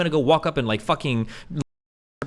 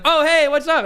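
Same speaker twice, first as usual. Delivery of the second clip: much higher and louder bright hello; retold greeting; 13 semitones higher, 7 dB louder than usual. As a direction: chirp the friend's hello. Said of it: "she's like".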